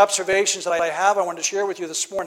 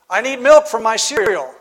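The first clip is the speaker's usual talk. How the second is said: put-on the child insists again, louder and a bit higher; louder repeated demand; 3 semitones higher, 9 dB louder than usual. Child repeating himself louder to a babysitting grandparent. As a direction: raise it, say it like a kid who was not heard.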